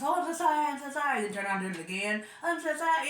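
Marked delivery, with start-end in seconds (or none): 0.0-1.2 mock apology. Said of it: "Then he goes". 2.4-3.1 mock apology. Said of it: "Then he goes".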